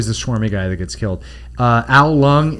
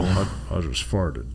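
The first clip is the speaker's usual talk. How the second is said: low gruff voice; 6 semitones lower, 8 dB quieter than usual. Retold or imitated. Low slow parody.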